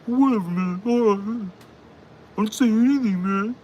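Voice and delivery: high timid mocking voice; mock cowering bigot. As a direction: play the coward backing off.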